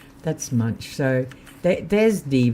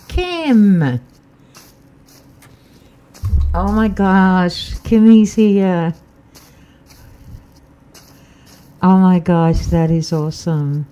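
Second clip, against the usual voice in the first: loud, high, delighted calling out; thrilled to see a friend arrive; excited greeting; 7 semitones higher, 10 dB louder, slower than usual. Real, not acted. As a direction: greet your friend with a loud happy shout. Greets friend joining live stream.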